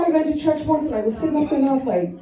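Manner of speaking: ordinary talk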